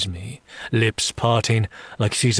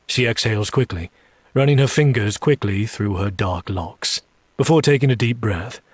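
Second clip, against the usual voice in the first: plain reading voice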